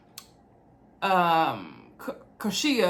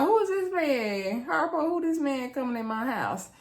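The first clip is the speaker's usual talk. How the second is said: baffled Chaz; 4 semitones higher than usual. Retold or imitated. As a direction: play the confused host lightly. Chaz baffled by uninvited guest.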